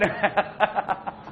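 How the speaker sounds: real burst of chuckling; a run of short, amused laughs; laughing. Real, not acted. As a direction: break off into a chuckle. Teacher laughing while chatting with class.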